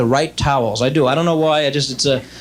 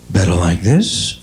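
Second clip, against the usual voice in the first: much lower, slow doctor offering a choice; low question rising slowly, then the alternative falling; eye doctor; 9 semitones lower, slower than usual. Acted. Eye doctor testing a patient's lenses, deep deliberate impression. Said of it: "my impression of every eye doctor in the world".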